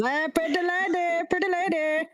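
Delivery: high, sleazy catcall of a frog man; a high, oily frog-man catcall; leering bullywug. Acted. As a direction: croon it like a creepy admirer. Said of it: "He goes".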